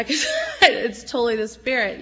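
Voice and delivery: tearful laugh breaking through the words; crying while laughing. Real, not acted. Woman testifying to church, laughing through tears. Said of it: "I just can't really even speak".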